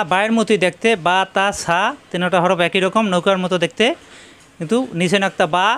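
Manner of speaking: ordinary delivery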